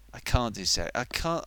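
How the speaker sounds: ordinary talk, nothing marked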